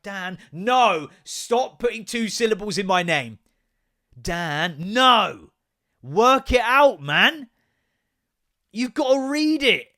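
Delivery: exasperated dad yelling at son. Retold or imitated. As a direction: yell it in total disbelief. Acting out frustrated shouting.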